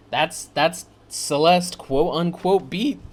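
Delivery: slow words with a cracking voice. Real, not acted. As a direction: voice breaks on the last word. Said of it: "the voice crack at the end".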